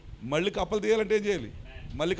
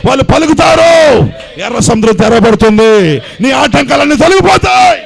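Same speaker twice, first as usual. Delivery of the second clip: climbing to a slow, much higher shout at the end; climactic preaching; 8 semitones higher, 16 dB louder than usual. Real, not acted. Pastor to the flock, a slow triumphant preaching shout. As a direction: drag out the last words in a triumphant cry.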